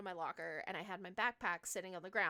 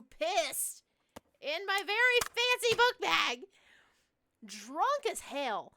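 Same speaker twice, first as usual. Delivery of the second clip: indignant outburst; 8 semitones higher, 10 dB louder, slower than usual. Real, not acted. Indignant climax of the story.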